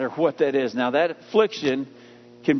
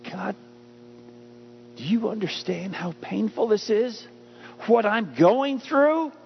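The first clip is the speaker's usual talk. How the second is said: pleading prayer. Acted. Praying to Jesus in affliction.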